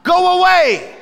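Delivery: high, loud demonic shout; evil spirit yelling go away; demon hollering. Acted. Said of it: "hollers out".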